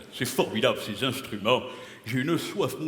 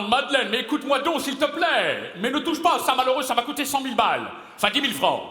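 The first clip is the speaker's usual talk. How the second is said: high-pitched comic pleading into the phone; 8 semitones higher than usual. Acted. Pleading with Madeleine on the phone, high and comic.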